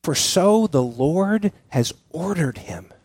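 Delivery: slow, measured scripture quote; slow, deliberate quote. Acted. David answers his indignant friend, slow and deliberate. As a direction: weigh each phrase slowly. Quoting David's response, slowly.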